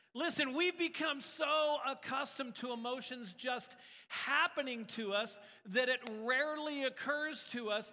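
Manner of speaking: loud, high fervent preaching. Acted. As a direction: preach it with fire. Preaching at full force.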